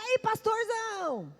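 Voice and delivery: high drawn-out hail of an over-familiar buddy; mock boisterous greeting. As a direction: imitate a churchgoer hollering chummily at the pastor. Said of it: "assim, falou".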